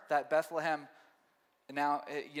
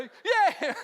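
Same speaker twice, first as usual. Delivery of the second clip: high, loud laughing yay; the dog bounding back joyfully; gleeful cheer; 12 semitones higher, 7 dB louder than usual. Retold or imitated. The dog refuses to leave and cheers, laughing. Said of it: "the movies when they say the dog".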